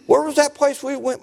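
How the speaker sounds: high-pitched imitation of wandering thoughts; mock lunch-planning chatter; distracted churchgoer. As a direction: muse aloud up high, as if trying to recall. Voicing a congregant's thoughts of lunch.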